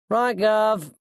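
cornered thief. A narrator's reading. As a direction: call back like a caught crook playing along. Cornered thief answers the callers.

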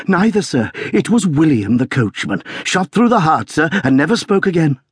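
high-pitched, agitated report; excited servant reporting to his master; shaken butler. A narrator's reading. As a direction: blurt out the awful news. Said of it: "propriety shaken out of him".

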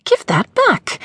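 high, sputtering maid's demand; high indignant servant woman. Acted. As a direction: snap it back like an outraged maid. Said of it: "she sputtered".

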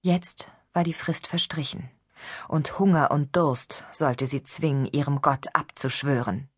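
usual speaking voice

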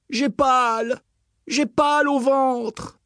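high, slow complaining voice; pained whining. Acted. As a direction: moan about a sore belly. Sick prince complains.

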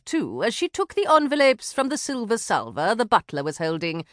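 ordinary delivery, nothing marked

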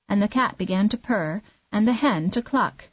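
plain reading voice

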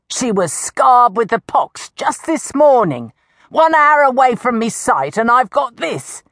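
high, indignant village accuser; outraged old woman. Acted. Old woman shows the crowd her cured granddaughter, high outraged rant.

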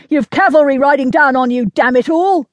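fast, high, loud officer's shout; bellowed warning. A narrator's reading. Officer warns lieutenant of cavalry riding down on him, bellowing. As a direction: bellow an urgent warning over battle noise. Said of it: "he bellowed again".

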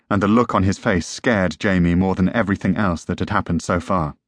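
usual speaking voice

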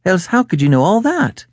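high-pitched, startled woman client; high, astonished question; astonished cry. Acted. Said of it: "she cried".

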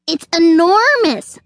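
high, irritated squirrel; grumpy snap. A narrator's reading. Squirrel losing patience with questions, snapping. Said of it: "snapped the squirrel".

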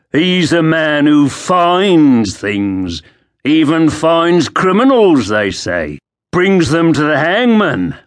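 high, slow, mocking soldier; sneering guard. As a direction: taunt him like a street thug. Guard mocks Crispin.

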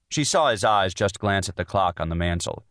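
ordinary delivery

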